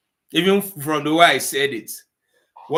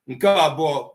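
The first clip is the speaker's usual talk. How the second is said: ordinary talk, nothing marked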